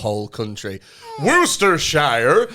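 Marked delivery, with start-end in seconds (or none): 1.2-2.5 "Worcestershire" drawn out as wʊstəʃaɪə, slow and rising; mock American saying "Worcestershire" loud; American accent mispronunciation. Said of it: "when I hear an American".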